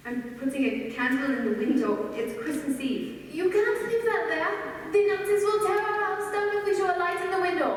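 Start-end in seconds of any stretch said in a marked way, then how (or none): 3.3-7.7 urgent, frightened rush to stop her; alarmed. Acted. Warning sister-in-law about the Nazis, alarmed and raised. Alarm over candle in window.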